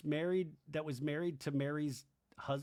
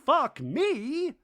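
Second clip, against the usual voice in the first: high, loud outraged question; hammed-up indignant retort; Bronwyn; 10 semitones higher, 8 dB louder than usual. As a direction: mimic affronted disbelief at full volume. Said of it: "And Bronwyn's like".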